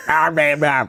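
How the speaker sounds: drawling nonsense imitation of American speech; slack-jawed noises mocking an American accent; American accent sound effect. Shown as a demonstration. Demonstrating how Americans sound, slack drawl noises. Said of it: "America would be like".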